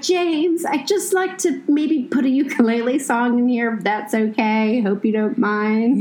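high, sweet, tentative asking voice; timid polite request. Retold or imitated. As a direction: ask very sweetly and hesitantly. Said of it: "I was like".

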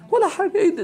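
high-pitched, laughing disbelief; laughing amazement. Real, not acted. World weighed against the hereafter, laughing amazement. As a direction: lift it high through a laugh. Amused, amazed repeat of the answer.

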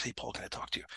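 hushed, near-whispered request; taking someone aside. Retold or imitated. Coworker takes a supervisor aside, hushed and confiding. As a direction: murmur it privately to a colleague. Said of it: "she takes him aside and says".

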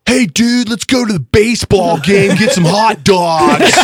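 imitating an Australian's cartoonish American accent; Australian doing American accent. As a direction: play an Aussie overdoing an American accent. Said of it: "Do an American accent".

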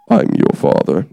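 Darth Vader. Pretend. Preacher clowning for his church, a light Darth Vader. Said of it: "I see I can do Darth Vader now".

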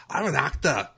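high, loud mock Scottish accent as David Tennant. Host imitating David Tennant for his co-host, in a Scottish accent. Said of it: "that's my best, uh, Scottish accent".

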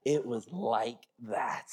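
low, slow, drawn-out comic insistence; mock insistence. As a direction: stretch out every word in a low voice. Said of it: "let me lie a little bit more".

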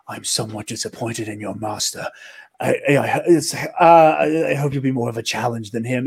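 lightly voiced anime villain; villain monologue. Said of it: "a fucking anime villain monologue".

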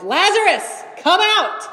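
a huge, high, ringing shout; commanding shout into the grave. A narrator's reading. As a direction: call him out of the tomb at full voice. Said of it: "Then he shouted".

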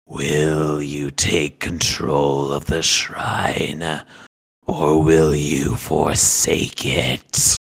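low, slow, eerie whispery voice; whispering voice. Acted. Sickle asking its holder to take the shrine, slow low whisper. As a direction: draw it out low like a sinister spirit. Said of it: "you hear a whispering".

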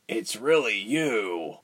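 slow, lightly croaked amazement; croaking. A narrator's reading. Prisoner recognizes Ilse through bars, slow mild croak. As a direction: draw it out with a touch of croak. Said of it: "his voice croaked".

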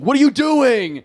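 high, drawn-out imitated yell; slow, indignant mock holler; angry bus driver. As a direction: yell it like an exasperated driver beckoning. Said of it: "get really angry".

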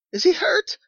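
very high, loud, frantic question; urgent demand. A narrator's reading. Mother cuts in on the visitor, demanding and frantic. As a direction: demand it in sheer alarm. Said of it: "demanded the mother".

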